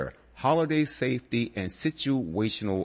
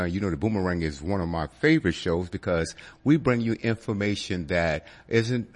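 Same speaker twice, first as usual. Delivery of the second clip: ordinary talk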